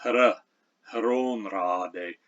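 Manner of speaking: hra and hronrade with the H sounded before the R; Old English hr onset on hronrade; sounded hr cluster. Shown as a demonstration. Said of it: "one of the glaring examples".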